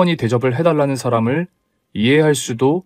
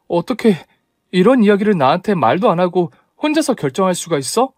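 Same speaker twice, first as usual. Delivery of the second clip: high-pitched, hurt disbelief; indignant reproach; 6 semitones higher than usual. Acted. Bride to fiancé who decided alone, indignant reproach.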